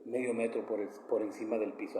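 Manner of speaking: a hint of his late mother's soft voice; mother's tender advice. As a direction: pass on a mother's gentle warning, hushed. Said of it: "Así me lo dijo mi madre".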